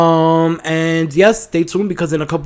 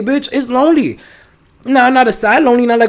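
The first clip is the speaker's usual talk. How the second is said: emphatic high; 9 semitones higher, 7 dB louder than usual. Real, not acted.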